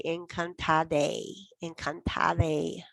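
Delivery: "encantade" drawn out very slowly; teacher modelling "encantade" slowly; slow model word. Shown as a demonstration. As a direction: stretch every syllable of "encantade". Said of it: "those people might say".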